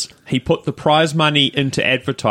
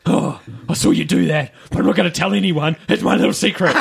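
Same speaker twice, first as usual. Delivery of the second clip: lightly voiced, higher, sly secret-keeper; conspiratorial stranger; 5 semitones higher, faster than usual. Retelling the Disney woman whispering a conspiracy to him. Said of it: "Weird Disney woman".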